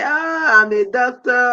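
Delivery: usual speaking voice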